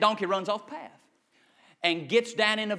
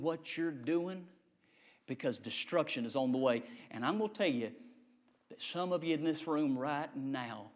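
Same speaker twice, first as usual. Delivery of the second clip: low, soft, measured preacher's voice; hushed preaching warning; 5 semitones lower, 8 dB quieter than usual. Acted. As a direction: drop low to warn the congregation. Grave warning to congregation.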